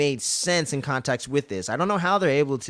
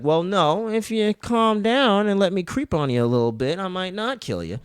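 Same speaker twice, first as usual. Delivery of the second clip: high, drawn-out mock coaxing; imitated creepy coaxing; 7 semitones higher than usual. Captor bargaining with his captive, mock coaxing. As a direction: lightly mimic a creep talking someone down, pitched up.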